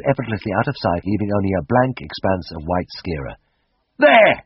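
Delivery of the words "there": high, loud triumphant cry; cried out. Acted. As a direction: call out like he proved his point. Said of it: "he cried".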